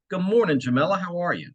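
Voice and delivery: a bright, easy hello to someone you know well; phone pickup to a seller who called back, breezy and familiar; familiar friendly greeting. Shown as a demonstration. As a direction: answer like an old friend calling. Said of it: "As if you spoke with her every day, like you've known her your whole life".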